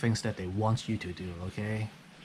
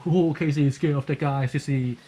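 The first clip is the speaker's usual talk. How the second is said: higher, louder playground taunt; teasing announcement to everyone; mock taunting; 4 semitones higher, 4 dB louder than usual. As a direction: imitate someone jeering to a crowd. Acts out taunter telling everyone.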